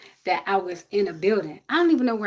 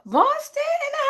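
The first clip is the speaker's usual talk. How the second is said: high sing-song shout-out; playful high shout-out; hype call. Real, not acted. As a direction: hype up the city, voice way up. Hyping chat city.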